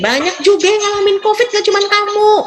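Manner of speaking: high, hammed-up know-it-all lecturing; mock preachy advice. Mocking a friend who lectures a covid patient, high and preachy.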